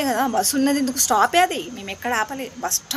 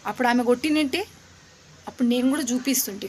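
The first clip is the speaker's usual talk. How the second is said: ordinary delivery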